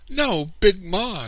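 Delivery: put-on very high, slow, loud child's protest; high-pitched, pleading shout; crying out. A narrator's reading. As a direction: cry out in drawn-out protest. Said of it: "I cried".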